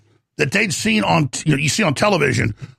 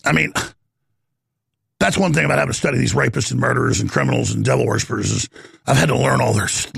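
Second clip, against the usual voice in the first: normal talking voice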